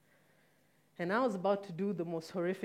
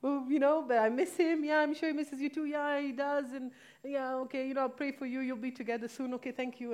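fake cheerful cover; 6 semitones higher, 3 dB louder than usual. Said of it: "And I'm like".

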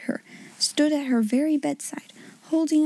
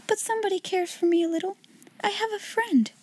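high, soft, teary voice; crying over a kind note; a little girl speaking through grateful tears; tearful girl; 8 semitones higher, 4 dB quieter than usual. Acted. Sarah crying.

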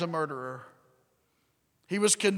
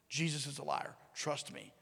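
lower, softer, a sly whisper; conspiratorial whisper; 4 semitones lower, 11 dB quieter than usual. Retold or imitated. Religious leaders whispering lies to the crowd, conspiratorial. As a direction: lean in and hiss the lie. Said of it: "amongst the crowd going".

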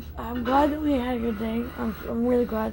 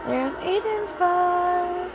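excited; 6 semitones higher, 3 dB louder, much slower than usual. Real, not acted. Spotting son's bus.

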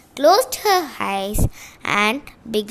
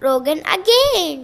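ordinary delivery

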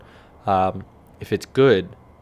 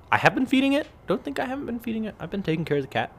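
defensive; 11 semitones higher, 4 dB quieter, faster than usual. Real, not acted. Defending himself to listeners.

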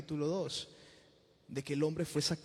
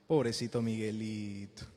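mock pity; 7 semitones lower, 5 dB quieter, slower than usual. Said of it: "vamos a compadecer al Señor".

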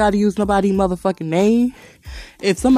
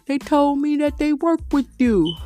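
higher, slow, mocking voice of a fake acquaintance; mock name-dropper; 8 semitones higher than usual. Mocking people who claim to know Darius, higher and slow. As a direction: put on a silly sing-song name-dropper.

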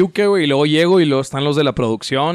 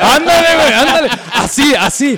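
frat bro; 10 semitones higher, 6 dB louder than usual. Acted. Cheering on a drunk scene.

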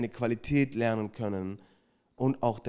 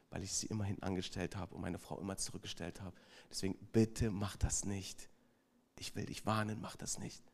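earnest warning; 7 semitones lower, 13 dB quieter than usual. Retold or imitated. Man earnestly warns the husband.

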